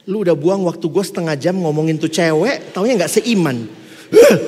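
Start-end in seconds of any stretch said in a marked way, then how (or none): 4.1-4.4 sharp loud huff of annoyance; exasperated huff. Acted. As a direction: blow out your frustration. Exasperated at wasted half hour.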